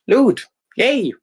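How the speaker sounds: high gleeful squeal; excited squeal. Acted. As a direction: squeal with delight at treasure. Finds a glowing book.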